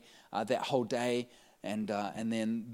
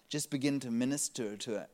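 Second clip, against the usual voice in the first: ordinary talk, nothing marked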